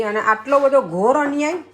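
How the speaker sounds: high, loud mock indignation; mock outrage. Wife to family at dinner, hammed-up loud outrage. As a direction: play up the injustice, loud and high.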